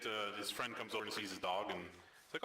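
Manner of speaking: ordinary talk, nothing marked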